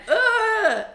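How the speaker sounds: high, loud mock groan of disgust; disgusted ugh. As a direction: throw out a shrill-free, hammed-up ugh. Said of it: "and you're like".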